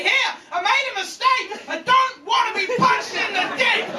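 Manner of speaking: very high, distressed mock cry; desperate plea. Homeowner finally admitting the mistake, desperate high-pitched plea. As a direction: blurt it out as a high desperate protest.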